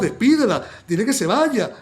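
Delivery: frantic disciples pleading loudly to be rid of her; desperate demand. Acted. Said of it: "los discípulos desesperados".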